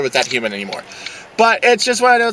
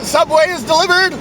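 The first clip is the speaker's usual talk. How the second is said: ordinary delivery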